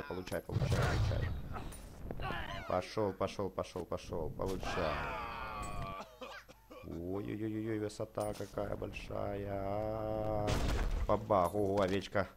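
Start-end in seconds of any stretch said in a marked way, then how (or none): none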